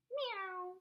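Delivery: high, soft playful meow; cat meow. Pretend. Teacher playing on the word cat, high soft meow. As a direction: mew like a little cat.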